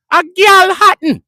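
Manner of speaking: slow, high, loud Jamaican "A Galhattan" stretched out; drawn-out Jamaican accent. Retold or imitated. Jamaican friend repeating his point, in a slow, stretched-out Jamaican accent. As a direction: drag "A Galhattan" out slowly in a Jamaican voice. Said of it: "That's what my Jamaican man said".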